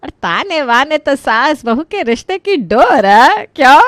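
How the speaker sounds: high, loud, drawn-out playful retort; loud teasing character voice, rising to the final question; bantering mother-in-law. Acted. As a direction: banter back with big swooping pitch.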